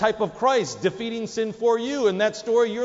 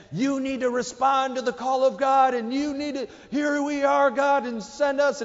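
higher, preachy pastor call, imitating; mock pastor; 6 semitones higher than usual. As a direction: ham up the altar call like a pastor. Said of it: "you hear a pastor say".

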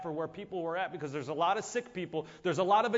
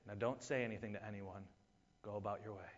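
soft, low confiding voice; hushed instruction; 7 semitones lower, 11 dB quieter than usual. Retold or imitated. Jesus telling a healed man to keep quiet, softly. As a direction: tell him quietly to keep it secret.

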